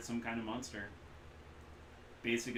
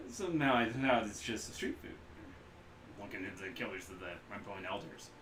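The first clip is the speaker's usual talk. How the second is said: ordinary delivery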